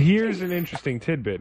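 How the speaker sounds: announcing it again through a laugh; laughing presenter voice. Shown as a demonstration. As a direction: restate it for the listeners, laughing. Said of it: "I'm talking to our lovely listeners".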